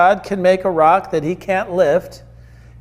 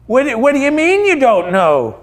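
higher-pitched, baffled questioning; incredulous angel; 5 semitones higher than usual. Acted. Angel to the thief who just arrived, incredulous. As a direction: challenge him in sheer disbelief.

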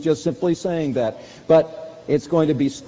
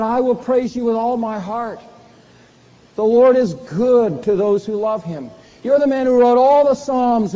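louder and higher, building reproach; preached rebuke; 6 semitones higher, 5 dB louder than usual. Acted. Preacher voicing Nathan's accusation of David, loud reproach. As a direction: drive each accusation home from the pulpit.

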